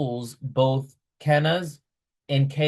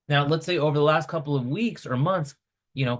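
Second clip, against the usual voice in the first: ordinary delivery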